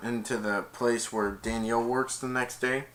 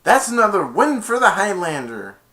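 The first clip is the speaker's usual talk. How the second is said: triumphant cheer; 8 semitones higher, 9 dB louder than usual. Retold or imitated. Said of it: "he's like".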